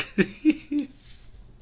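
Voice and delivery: high, loud burst of giggling; real laugh. Real, not acted. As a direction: crack up mid-story. Cracks up while retelling.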